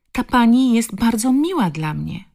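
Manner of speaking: put-on high, pleading little girl; child's protest. A narrator's reading. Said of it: "zaprotestowała mała". (dziecięcy, proszący protest)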